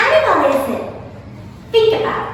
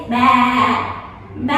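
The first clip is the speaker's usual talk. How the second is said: short imitated baas; sheep bleating; 4 semitones lower than usual. Shown as a demonstration. Teacher giving children a fluffy animal clue, imitated bleats. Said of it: "it sounds like this".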